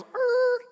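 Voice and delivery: high imitated tire screech; screeching brakes. Dad pulling the truck over, screeched brake noise.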